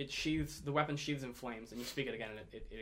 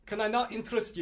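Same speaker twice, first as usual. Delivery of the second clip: higher, louder coaxing pitch; dwarf salesman; 8 semitones higher, 6 dB louder than usual. Acted. Shopkeeper tempting departing customers, insistent dwarf voice. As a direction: try to hook the customer.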